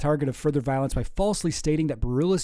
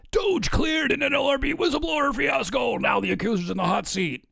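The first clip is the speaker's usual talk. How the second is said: high, shouted hype-man read; hammed-up announcer; 11 semitones higher than usual. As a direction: blare it like a breathless news hook. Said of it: "he definitely doesn't talk like that".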